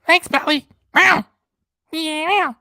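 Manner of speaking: high, loud cat voice without singing; Super Chat Cat. Pretend. Cat mascot thanking a viewer who asked for no singing, high loud cat voice. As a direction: squeal a spoken thanks with loud meows. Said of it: "not to have Super Chat Cat sing".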